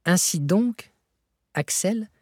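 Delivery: strange, high, questioning lilt; odd tone. A narrator's reading. Professor to his discouraged nephew, in an odd tone. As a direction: lift it oddly, as if probing. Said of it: "reprit le professeur d'un ton bizarre".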